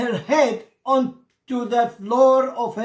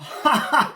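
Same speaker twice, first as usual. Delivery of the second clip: high triumphant laugh; bright mocking laugh; gleeful laugh; 7 semitones higher than usual. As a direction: laugh at the fooled master. Freed bird laughs.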